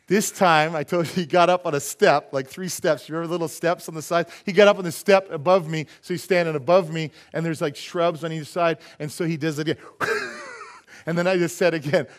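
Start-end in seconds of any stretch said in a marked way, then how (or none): none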